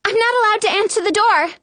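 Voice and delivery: high, quick called-out line; Snow. A narrator's reading. Snow to the stranger through the curtains, mild yell. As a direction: call it fast and high, without real shouting. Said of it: "Snow yells through the curtains".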